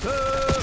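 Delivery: high, loud "ah"; high held yelp; startled cry. Real, not acted. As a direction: yell out in sudden alarm. Startled during the game.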